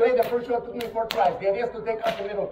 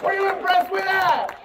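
excited; 11 semitones higher, slower than usual. Acted. Showman asks crowd.

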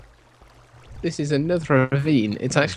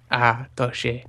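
put-on French accent; 2 semitones lower, 4 dB quieter, slower than usual. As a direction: murmur it like a French perfume advert. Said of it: "say anything in a French accent".

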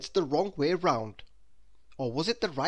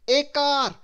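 loud, high character voice; Mister Topsy-Turvy explaining; 11 semitones higher, 9 dB louder than usual. A narrator's reading. Mister Topsy-Turvy reports to the police, agitated. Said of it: "he explained".

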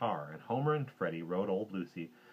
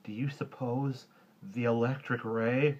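slow, higher, quavering question; worried wobble, rising at the end; quavery; 4 semitones higher, 3 dB louder, slower than usual. A narrator's reading. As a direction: ask haltingly as a frightened boy. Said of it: "in a quavery voice".

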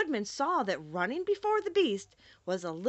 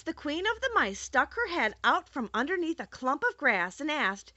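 usual speaking voice

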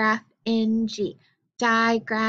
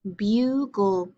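normal talking voice

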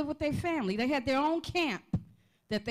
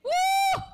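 very high, loud shout of joy; ecstatic whoop; 8 dB louder than usual. Real, not acted. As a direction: cry out in full fervor. Preacher exclaiming mid-sermon.

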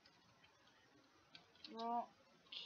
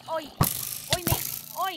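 mock British oi; 7 semitones higher, 13 dB louder, faster than usual. Taunts mob in British voice.

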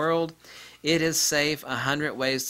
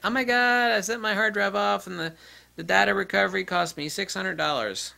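higher, mock complaining customer; whiny customer; 7 semitones higher than usual. Imitating a customer griping about a repair bill.

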